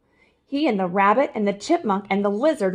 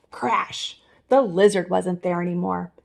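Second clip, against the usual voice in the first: ordinary delivery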